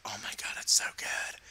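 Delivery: whispered in awe; hushed amazed whisper; awed whisper. Retold or imitated. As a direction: breathe it out like a discovery. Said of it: "I was like".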